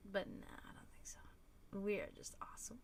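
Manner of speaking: unvoiced playful whisper; joking whisper. Real, not acted. Boasting to her co-host in a teasing whisper. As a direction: whisper it like a cheeky secret. Joking aside after a denial.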